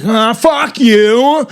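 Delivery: drawn-out "you" rising at the end; sing-songy Nick Cage. Shown as a demonstration. Comic demonstrating how Cage swoops, sing-song impression. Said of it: "go down and be like".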